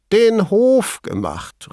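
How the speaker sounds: high-pitched, drawn-out incredulous cry; amused exclamation. A narrator's reading. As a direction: call it out high and slow, hooting. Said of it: "rief er". (hoch und gedehnt amüsiert ausgerufen)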